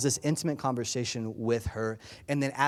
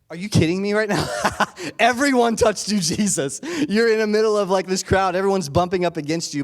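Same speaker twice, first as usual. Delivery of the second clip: loud, high, incredulous outburst; exasperated disciples; 7 semitones higher, 7 dB louder than usual. Retold or imitated. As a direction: throw it at him, half-laughing in disbelief.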